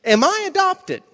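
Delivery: hesitant question. Retold or imitated. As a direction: work up the nerve to ask mom. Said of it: "I said".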